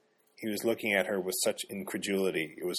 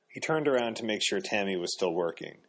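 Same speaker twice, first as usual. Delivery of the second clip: usual speaking voice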